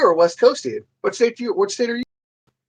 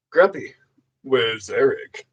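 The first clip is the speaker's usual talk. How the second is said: slow, lower mock old lady asking; mimicking grandma; 5 semitones lower, slower than usual. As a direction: drags out the question like a frail grandma. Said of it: "She's like".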